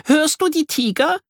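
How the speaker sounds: put-on high eager girl; high excited girl voice; thrilled girl. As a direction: ask with thrilled suspense. Miri in pretend jungle.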